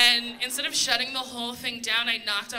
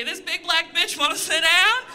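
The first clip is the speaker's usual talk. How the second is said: louder, higher put-on voice of a fed-up flight attendant; mock flight attendant complaint; 5 semitones higher, 5 dB louder than usual. Flight attendant on the phone reporting her, put-on exasperated voice. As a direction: sneer it like the crew reporting her.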